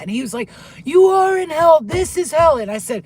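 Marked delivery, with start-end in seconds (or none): 0.8-2.6 very loud, high insistent yell; demonic screaming. Retold or imitated. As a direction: imitate a scary giant screaming someone down. Retold screaming argument with demon.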